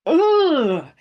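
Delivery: loud high-pitched ugh; distressed groan. Real, not acted. Owner fearing her dog has melanoma, distressed groan. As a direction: let out a pained, high groan that falls away. Upset over dog's possible melanoma.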